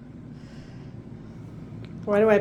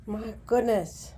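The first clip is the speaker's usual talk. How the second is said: ordinary delivery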